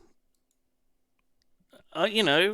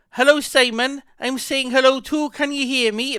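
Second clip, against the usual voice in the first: higher, louder mimic of Misery's accent; 10 semitones higher, 8 dB louder than usual. Streamer greets Simon imitating Misery's accent. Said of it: "That's Misery's accent".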